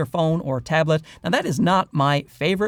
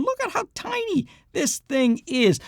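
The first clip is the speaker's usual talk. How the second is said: excited; 11 semitones higher than usual. Real, not acted. Excited over tiny mic.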